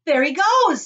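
high, loud call from the crowd; excited shout. A narrator's reading. Onlooker spots boy in pipe, shouting. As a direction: shout out the sighting. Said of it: "somebody shouted".

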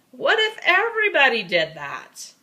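the official calling out to the fan who ran on the field. Acted. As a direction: call the child off the field.

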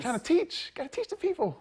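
high mock teacher voice; playful preacher voice. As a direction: hype it like a street preacher. Playful professor persona boast.

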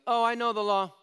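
high, loud mocking know-it-all voice; smug know-it-all. Mocking the self-satisfied believer, high and loud.

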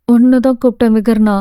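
usual speaking voice

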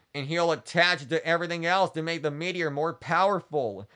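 ordinary delivery, nothing marked

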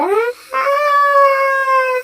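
high, drawn-out mock scream; long cartoon scream. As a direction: cry out long and high as the ring drops. Ring falls, figure cries out.

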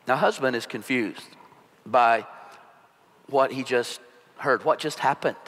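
ordinary talk

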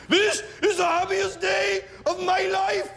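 very high, slow, drawn-out monotone wail; hammed-up tormented Welsh groom intoning his vows; pissed-off Welshman. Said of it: "he sounds pissed off, doesn't he".

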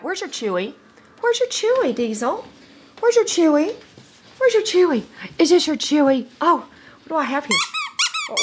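high, loud teasing squeal; excited doggy talk. Real, not acted. Breeder teasing Diesel with his Chewy, high and loud. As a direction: tease the dog with the toy, way up high.

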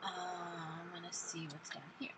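hushed sneaking whisper. Real, not acted. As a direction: breathe the words, barely voiced. Sneaking through the level.

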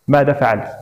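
usual speaking voice

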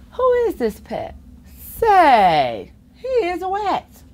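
ordinary delivery

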